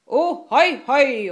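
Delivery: high, loud double hail; pirate shout. A narrator's reading. Pirate Enøje cheers the captain on, shouting. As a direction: whoop it out across the ship. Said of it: "råbte Enøje".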